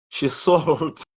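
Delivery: a laugh slipping into the words; half-laugh while meaning to be serious; sounding like laughing. Real, not acted. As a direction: break briefly into an unintended laugh. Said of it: "I sound as if I'm laughing".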